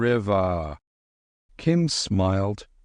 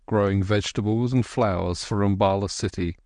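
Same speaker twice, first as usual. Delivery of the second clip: ordinary delivery, nothing marked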